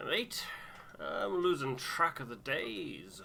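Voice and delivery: a tired bloke drawling; weary settler. Acted. Settler Tucker voiced.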